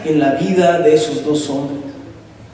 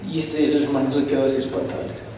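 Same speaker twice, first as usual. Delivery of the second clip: usual speaking voice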